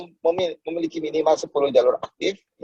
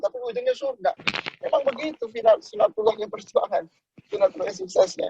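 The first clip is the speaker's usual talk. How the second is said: much higher, rising preaching; soaring preacher climax; 4 dB quieter than usual. Acted. Speaker preaching to the audience about success, fervent. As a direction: lift the voice to a fervent peak. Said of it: "preaching climax".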